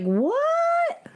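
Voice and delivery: stretched "what" soaring very high; shocked. Retold or imitated. Guest recalling her reaction to the list, shocked. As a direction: re-enact stunned disbelief in a high rising voice. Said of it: "I was shocked".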